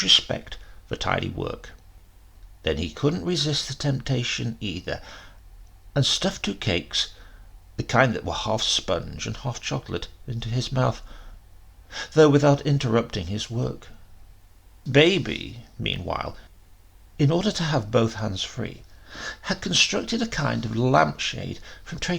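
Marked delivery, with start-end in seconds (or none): none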